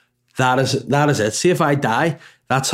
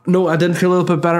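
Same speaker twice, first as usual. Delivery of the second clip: sudden self-correction; 8 semitones higher, 5 dB louder, much faster than usual. Real, not acted. Correcting himself mid-story.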